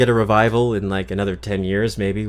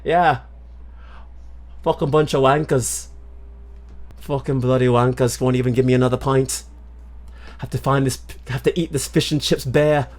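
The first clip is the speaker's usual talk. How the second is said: hammed-up British pub grumbler, higher-pitched; attempted British accent; 4 semitones higher than usual. Drinker denied another pint, mock British accent. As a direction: grouse in a comic British accent. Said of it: "my attempt at British accent".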